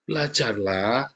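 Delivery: ordinary delivery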